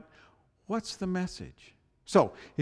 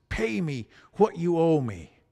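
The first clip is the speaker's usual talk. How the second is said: louder, harsh demand; 5 dB louder than usual. Retold or imitated. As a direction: grab him and demand the money. Grabs debtor by the throat.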